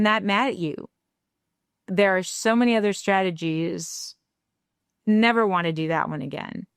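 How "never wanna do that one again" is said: ordinary delivery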